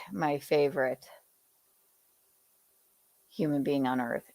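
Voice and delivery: ordinary talk, nothing marked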